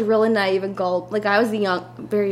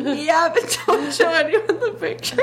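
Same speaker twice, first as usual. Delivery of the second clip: slow, giggling half-warning; laughing warning; 7 semitones higher than usual. Retold or imitated. Sister warns while laughing, giggling slowly. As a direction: warn through a giggle, high and slow.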